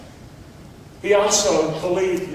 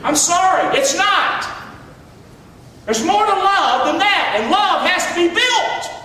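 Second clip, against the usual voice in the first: preaching shout; 8 semitones higher than usual. Real, not acted. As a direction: lift it up and drive it home. Preacher rebuts a crude idea.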